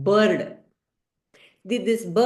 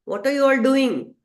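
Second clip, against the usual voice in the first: a slightly raised, louder asking; puzzled question; 5 semitones higher, 5 dB louder than usual. Acted. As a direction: call out to the busy birds, puzzled. Relatives question the birds.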